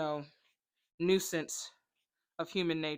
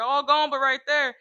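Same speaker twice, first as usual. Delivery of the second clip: louder, higher exclamation; exclaiming; 5 semitones higher, 8 dB louder than usual. Retold or imitated. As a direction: throw the line out big. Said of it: "And it's like".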